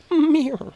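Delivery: high little put-on mewl; tiny mock squeal. Host joking how he really sounds, high mock mewl. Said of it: "But really, I'm like".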